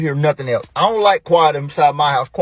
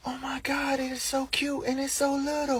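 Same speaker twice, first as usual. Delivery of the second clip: high, soft cooing; put-on squeal of adoration, kept soft; gushing woman; 10 semitones higher, 11 dB quieter than usual. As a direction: coo over a tiny puppy. Woman cooing over puppy.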